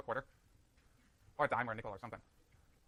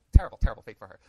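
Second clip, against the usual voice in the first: loud, sarcastic lament over a dream job; mock pity; 6 semitones lower, 14 dB louder than usual. Teasing his sister's handbag job with loud mock sorrow. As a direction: ham up the tragedy, tongue in cheek.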